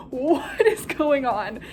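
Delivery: slow, breathy, half laughing; baffled student watching chaos; stunned disbelief. Retold or imitated. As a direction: drag it out, baffled. Stunned by the delivery.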